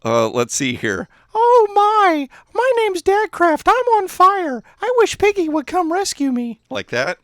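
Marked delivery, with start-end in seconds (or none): 1.3-6.6 very high, loud falsetto parody; Dadcraft. Acted. Streamer redoing a friend's plea for rescue, even higher mock voice. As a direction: push it way up into squeaky falsetto. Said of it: "need to have my voice a little higher".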